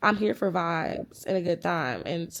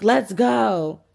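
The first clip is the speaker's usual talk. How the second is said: louder, insistent imitation of a fed-up date; retold exasperated command; impatient call; 3 semitones higher, 7 dB louder than usual. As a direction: act out hurrying someone along, loudly. Ignored date repeats his call.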